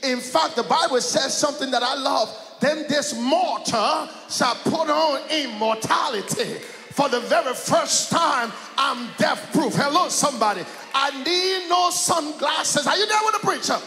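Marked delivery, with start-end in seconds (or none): 1.9-13.8 loud, rising sermon cadence, calling out to the church; whooping gospel preacher building to a peak; full-force preaching. Acted. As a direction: preach it up with the music behind you.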